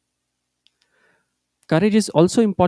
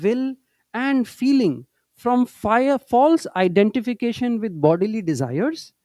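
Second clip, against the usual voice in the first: slow, measured recitation of a holy text; teacher reciting Master's words to students, slow and solemn; scripture reading; 3 semitones higher, slower than usual. Acted. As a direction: read each phrase slowly and with reverence. Reads scripture quotation slowly.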